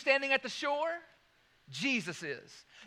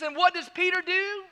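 ordinary delivery

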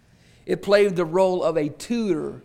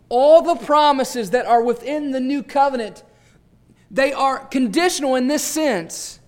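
rising, louder preacher's swell; fervent preaching; 5 semitones higher, 6 dB louder than usual. Acted. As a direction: lift the congregation with it. Preaching at full force.

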